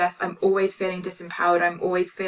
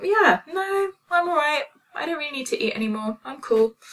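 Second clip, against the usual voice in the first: much higher, casual and light; breezy self-talk; 8 semitones higher than usual. Retold or imitated. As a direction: toss it off carefree. Breezy inner voice quote.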